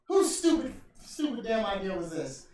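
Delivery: high, loud, indignant yell; hammed-up fury at a dumb idea; mock angry outburst. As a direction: blow up at the boss. Austin berating Vince.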